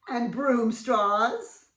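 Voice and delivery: chuckling through the words; amused giggle; laughing. Real, not acted. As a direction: read it while laughing. Laughing at picture.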